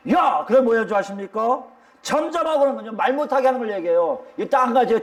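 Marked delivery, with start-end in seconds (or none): 0.1-0.5 high, sudden scolding call; rebuking the noisy. Acted. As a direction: cut through the chatter sharply. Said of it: "시끄럽게 떠드니까". (떠드는 사람을 꾸짖듯 높게)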